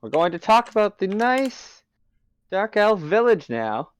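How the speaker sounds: loud, high-pitched, drawn-out announcement; talking loudly. Acted. Talking over the humans' rowdiness, loud and obnoxious. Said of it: "like, talk loudly".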